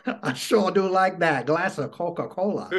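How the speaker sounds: put-on laughing Southern accent. Friends riffing on plantation talk, in a laughing mock Southern voice. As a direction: play the Southern gentleman while laughing. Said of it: "that old plantation Southern accent".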